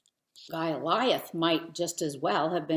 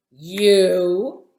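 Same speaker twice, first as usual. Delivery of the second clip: much louder scornful giant's sneer; 15 dB louder than usual. A narrator's reading. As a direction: sneer down at the tiny boy. Giant sneers at small boy.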